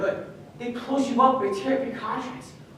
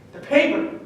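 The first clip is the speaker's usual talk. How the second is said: anguished outburst; 6 semitones higher, 8 dB louder than usual. Acted. Father explains the news.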